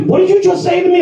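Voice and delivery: high, indignant mock challenge; offended retort. Customer confronts the bartender, high mock indignation. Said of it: "he just stops and he looks at me".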